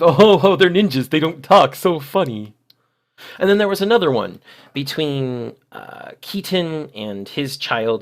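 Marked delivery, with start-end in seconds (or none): 0.0-2.5 mocking localizers. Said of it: "As if".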